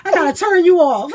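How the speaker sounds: teasing goodbye through real laughter; laughing. Real, not acted. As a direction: laugh the threat away.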